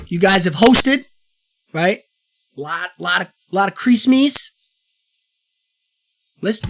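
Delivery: ordinary talk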